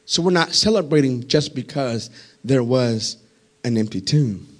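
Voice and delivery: hushed preaching. Real, not acted. Preacher quietly explaining the proof of resurrection, hushed and low. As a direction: pull right back and draw the listeners in. Sudden drop after climax.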